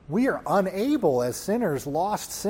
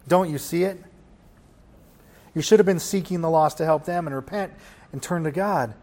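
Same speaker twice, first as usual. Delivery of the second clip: ordinary talk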